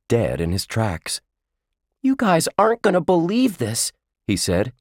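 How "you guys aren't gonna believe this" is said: put-on high, bright amazed kid voice; can't believe what he just saw; excited kid. A narrator's reading. Josh excited, flying watermelon.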